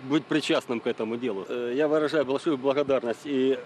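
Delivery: usual speaking voice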